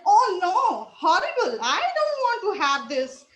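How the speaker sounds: loud, high cry of disgust; horrified refusal. Acted. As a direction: recoil in acted horror, pitch jumping up. Refusing offered cockroach wings.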